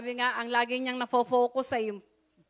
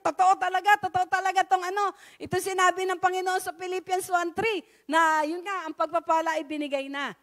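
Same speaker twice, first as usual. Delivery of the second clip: joyful testimony; 6 semitones higher, 3 dB louder than usual. Retold or imitated. Maid's joyful testimony quoted.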